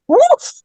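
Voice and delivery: high sweeping woof sound; playful mock swoosh; swoosh noise. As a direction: sweep the blade with a rising whoosh.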